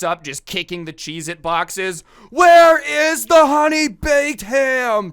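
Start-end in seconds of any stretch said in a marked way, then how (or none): 2.3-5.1 much higher, much louder, slow screaming demand; imitated Karen shrieking for the ham; freaking out. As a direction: scream it like a customer losing her mind. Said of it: "Just freaking out".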